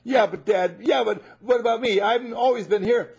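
high, loud complaining son; whiny older son. Acted. Jealous older son protesting to Dad, loud and whiny. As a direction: whine like a jealous brother.

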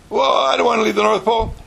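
high, loud, fast whine; protesting child. Retold or imitated. Child complains loudly about leaving the North Pole. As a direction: protest like a kid who won't leave.

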